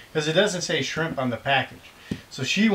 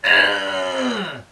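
mild mock stadium yell, higher; imitating fan's yell; 5 semitones higher, 4 dB louder than usual. Dad describing the fan's wordless chant, mock yell. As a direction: holler like a wordless fan. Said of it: "but it sounds like".

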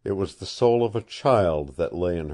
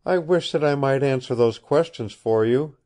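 ordinary delivery

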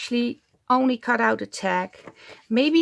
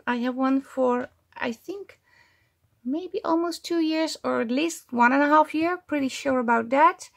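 usual speaking voice

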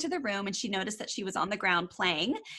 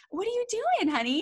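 much higher, lilting mom voice; mom asking her child; 12 semitones higher than usual. Retold or imitated. Mother quoting a mom gently asking her toddler, high and sweet. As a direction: sing it sweetly down to a little one. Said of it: "she says".

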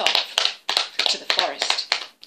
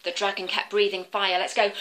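ordinary delivery, nothing marked

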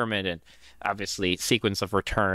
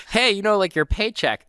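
higher, louder, breezy boss voice; mock cheery boss; 10 semitones higher, 5 dB louder than usual. Boss talks to a worker about payday, breezy and loud. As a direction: play the cheerful boss handing it over. Imagined future boss handing pay.

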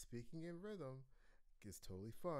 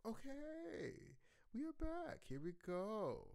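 bright return; 11 semitones higher than usual. Real, not acted. Cheerful return after pause.

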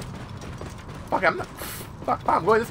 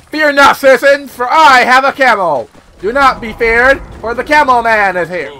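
loud, grand hammed-up proclamation; mock hero; 5 semitones higher, 12 dB louder than usual. Camel rider proclaiming to the citizens, mock heroic. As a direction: announce yourself like a pompous hero.

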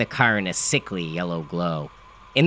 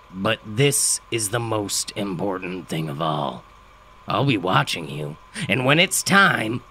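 gravelly father, grave; 2 semitones lower than usual. A narrator's reading.

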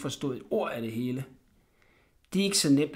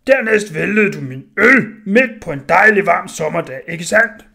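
angry blacksmith; 3 semitones higher, 12 dB louder than usual. Acted. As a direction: hammer each charge home. Smith scolds Orla.

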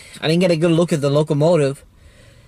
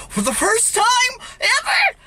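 ecstatic cry. Real, not acted. Railfan seeing the train for the first time, ecstatic cry. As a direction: cry it out, overwhelmed.

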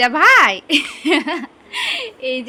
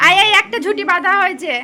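eager calling; 6 semitones higher, 4 dB louder, faster than usual. Real, not acted. Calling toddler for hair tying.

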